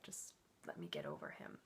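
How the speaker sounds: low, soft inner grumble; quietly stubborn under the breath; low muttered aside. Retold or imitated. Retold stubborn thought about twin.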